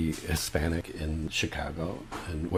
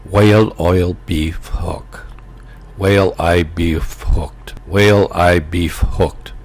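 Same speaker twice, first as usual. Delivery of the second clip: loud, slow Irish accent drill; Irish accent demo; 9 dB louder than usual. Shown as a demonstration. Teaching listeners an Irish accent joke phrase, loud and slow. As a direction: say it over and over in a brogue. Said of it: "then you just say those words".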